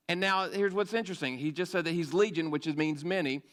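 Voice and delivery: normal talking voice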